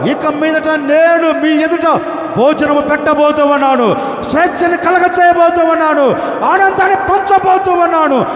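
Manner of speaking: high, loud, driving sermon cadence; preaching at full force. Acted. Pastor preaching to the congregation about their burdens, fervent. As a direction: preach it hard to the crowd.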